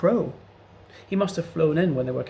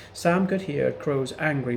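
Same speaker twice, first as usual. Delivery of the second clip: plain reading voice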